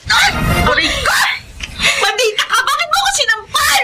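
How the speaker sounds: high indignant shriek at the slapper; shrill outraged yelp. Acted. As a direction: yell after being slapped. Mistress just got slapped.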